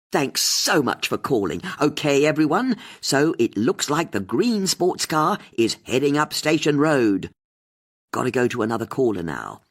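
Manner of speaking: rally the listeners; host appealing to listeners on air; radio host. A narrator's reading.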